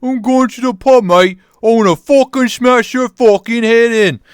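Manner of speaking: put-on high, loud pub-brawler British accent; hammed-up British yelling. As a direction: threaten like a rowdy British lout. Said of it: "There's your British voice".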